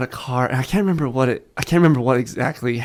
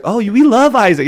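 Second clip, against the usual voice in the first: high, louder fond gushing; gushing to a child; 10 semitones higher, 6 dB louder than usual. Acted. Uncle example for the kids, gushing to a child. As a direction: gush it for a little one.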